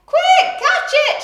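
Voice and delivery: very high, loud urgent shout. Acted. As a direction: yell for help catching the cat. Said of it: "shouted the nurse".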